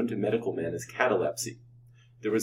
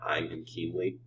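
ordinary delivery

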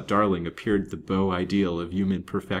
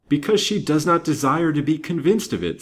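higher, louder, emphatic grim retort; grimly insist, with raised voice; 9 semitones higher, 6 dB louder than usual. A narrator's reading. Banker warning his young friend about the widow, grim. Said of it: "said the banker grimly".

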